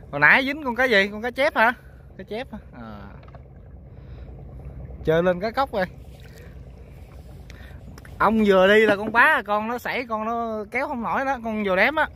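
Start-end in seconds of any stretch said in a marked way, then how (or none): none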